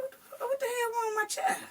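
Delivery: high, fast alarmed outburst; a mother's shocked high cry at her child; sudden panicked high-pitched question. Retold or imitated.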